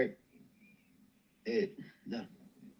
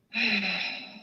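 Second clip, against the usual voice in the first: long, weary exhale; a loud tired sigh; heavy sigh; 10 semitones higher, 4 dB louder than usual. Real, not acted. Sigh after "it's real serious.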